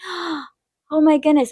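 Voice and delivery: high, drawn-out amazement; retold stunned realisation; awestruck. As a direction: let it out slowly after a gasp.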